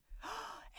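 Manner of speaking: a short breathy scared gasp; frightened gasp. A narrator's reading. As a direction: gasp at the noise.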